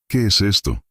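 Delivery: high-pitched, alarmed question; trembling murmur. A narrator's reading. Man frozen before the creature, frightened question. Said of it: "murmuré, con la voz temblando".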